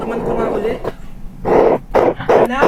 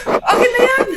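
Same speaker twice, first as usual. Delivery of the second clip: desperate; 6 semitones higher than usual. Acted. Grabbing the cure.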